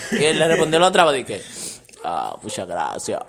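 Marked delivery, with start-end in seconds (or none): 2.0-3.2 much lower, slow and forced, pushing the voice down; mock deep seductive voice, put on to impress. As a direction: drop the voice way down and force it out slowly. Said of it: "Forzó la voz más".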